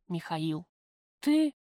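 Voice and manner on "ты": high, louder shocked question; startled gasp. A narrator's reading. Prisoner sees her lover walk into the cell, startled. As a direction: let the surprise burst out. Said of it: "выдохнула я, хватаясь за горло".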